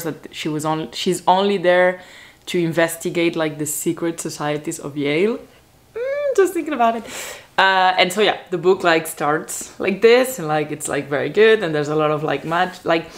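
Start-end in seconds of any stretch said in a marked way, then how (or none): 5.9-7.0 tiny high voice of giddy delight; high, swooning little aside; high-pitched gush. Real, not acted. Gushing aside about book she loved.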